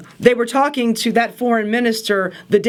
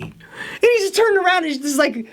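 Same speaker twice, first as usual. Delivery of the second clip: ordinary talk, nothing marked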